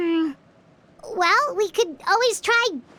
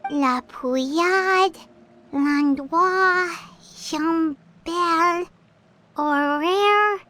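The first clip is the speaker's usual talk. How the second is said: slow, drawn-out list reading; halting read of unfamiliar names; much slower than usual. Acted. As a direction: sound out each name slowly, pausing between.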